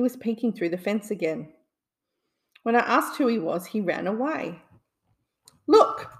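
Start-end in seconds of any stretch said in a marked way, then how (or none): 5.7-6.1 excited cry. A narrator's reading. Spotting the man caught.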